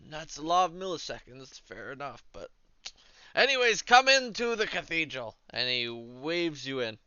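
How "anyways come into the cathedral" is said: priest voice. Acted.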